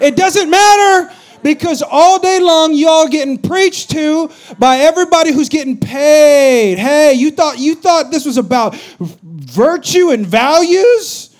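high, loud, slow preaching build; full-force preaching. Acted. Pastor rebuking his congregation, slow full-force preaching. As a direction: thunder it at the congregation.

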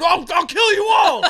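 yelled movie line. Retold or imitated. Fan yelling the actor's movie line at him, high mock-villain yell. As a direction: scream it in the actor's face. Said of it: "I just yelled it right in his face".

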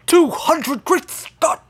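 high, astonished mad-inventor outburst; Doc Brown. Acted. Inventor reacts to the boys' price, astonished Doc Brown voice. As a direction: exclaim it high-pitched like an eccentric movie scientist. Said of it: "you sound just like Doc Brown".

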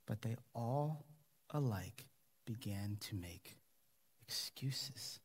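slow, soft and sorrowful, trailing to a near whisper; sad. Acted. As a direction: let the words sink with grief, slowly. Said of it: "You hear the sadness in Jesus' voice".

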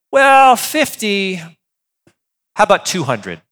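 slow, drawn-out bargaining counter; haggling counteroffer. Pretend. God imagined as a haggler, slow teasing counteroffer. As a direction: stretch it like a haggler upping the price. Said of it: "God should go".

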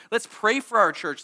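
ordinary delivery

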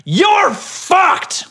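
high shouted threat; mock scream. Chainsaw man screaming at beachgoers. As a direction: yell it like a crazed attacker.

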